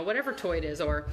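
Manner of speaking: ordinary talk, nothing marked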